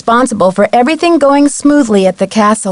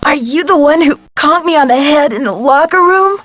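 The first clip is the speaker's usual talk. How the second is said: higher, strained voice broken by coughs; choking, coughing distress; 4 semitones higher than usual. Acted. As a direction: confront the culprit through a coughing fit. Coughing while confronting culprit, eyes hurt.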